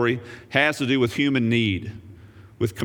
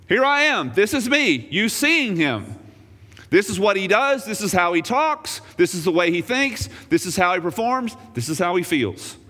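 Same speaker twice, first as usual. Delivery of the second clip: Jesus pleading; 7 semitones higher than usual. Retold or imitated. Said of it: "He says to Philip".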